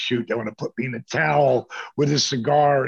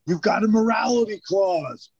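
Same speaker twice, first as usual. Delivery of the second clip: higher, heated but not screaming; mock a boss's exasperated scolding; imitating an annoyed boss, not yelling; retold pissed-off boss; 7 semitones higher than usual. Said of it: "It wasn't like he was screaming pissed".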